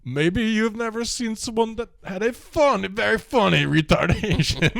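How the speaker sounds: Borat's foreign lilt pushed high; Borat impression. Woman quoting Borat replying to the counselor in his accent. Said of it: "Borat's like".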